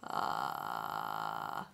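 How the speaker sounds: low creaky door groan; vocal fry creak. Shown as a demonstration. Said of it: "how to be a creaky door".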